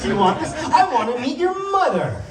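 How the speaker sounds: mock pushy suitor, pitch climbing; pushy male pigeon. Pigeon pressing her again.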